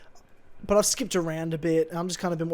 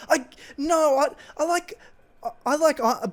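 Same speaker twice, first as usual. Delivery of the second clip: higher, louder mock mum stammering then swearing; flustered mum; 7 semitones higher, 9 dB louder than usual. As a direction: imitate mum fumbling, then cursing Iron Man loudly. Mum voiced, stammering then cursing.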